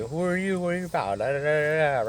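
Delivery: high-pitched imitation of an interrogating stranger; challenging questioner. Big dude sizing up a new inmate, challenging.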